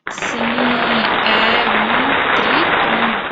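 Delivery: slow, loud, drawn-out answer; Sim, é um tritongo stretched out slowly and loudly; triumphant reveal. Acted. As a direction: announce it like a prize.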